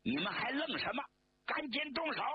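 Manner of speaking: 音调猛升的厉声催促; sharp, high shouted demand; 厉声喝问. A narrator's reading. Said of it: "厉声喝问道". (厉声喝问)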